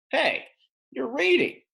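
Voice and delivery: high, loud gotcha; catching her out. Acted. Narrator kid catches the teacher reading, high and loud. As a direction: pounce on the discovery. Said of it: "I said".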